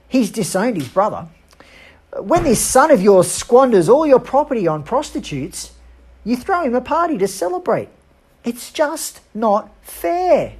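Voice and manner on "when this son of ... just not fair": rising resentment, drag out not fair; bitter complaint. Acted. Older son complains to the father, bitter and higher.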